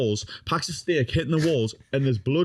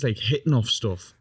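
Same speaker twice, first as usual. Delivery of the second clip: ordinary talk, nothing marked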